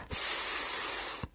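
long soft snake hiss. Acted. Narrator imitates snake speech for listeners, hissing.

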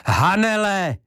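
high, loud shout; shouting at full voice. A narrator's reading. Karadžič shouts for Hanele in the silent house. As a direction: shout her name with full voice. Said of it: "křičel plným hlasem".

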